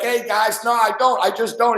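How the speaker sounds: high chipper goody voice; cheery clean-cut reply. Retold or imitated. Quarterback politely refusing to swear, in a high chipper voice. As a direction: answer brightly like an eager Sunday-school kid.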